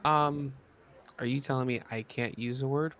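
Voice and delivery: normal talking voice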